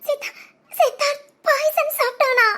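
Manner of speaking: stammering out bad news through sobs; sob, then force the words out; crying. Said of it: "எதுக்குடா அழுகுற". (தேம்பி அழுதபடி)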